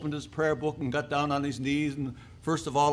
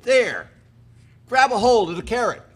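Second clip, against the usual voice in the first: raised, louder instruction; urgent command; 7 semitones higher, 7 dB louder than usual. Acted. Angel telling the woman to grab hold, commandingly. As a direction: order it high and forcefully.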